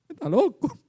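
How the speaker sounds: scoffing, laughing high voice of the mourners; mocking laugh. Mourners mocking Jesus, laughing scornfully. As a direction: laugh him off as mad.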